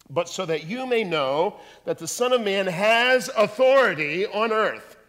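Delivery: ordinary delivery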